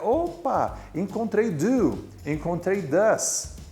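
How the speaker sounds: high-pitched, drawn-out eureka; pleased "aha, found it" voice; acted discovery. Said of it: "você vai ler o texto e identificar".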